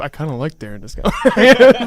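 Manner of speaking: loud, laughing imitation of a friend's sudden change of heart; teasing mock quote. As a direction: tease him loudly, slowing down through laughter. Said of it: "went from so angry to".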